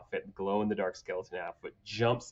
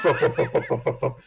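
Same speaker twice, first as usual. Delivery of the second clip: laughing booms, louder; mock boom sounds; 3 semitones higher, 7 dB louder, faster than usual. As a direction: pop each goblin down with a laugh. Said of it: "goblins falling".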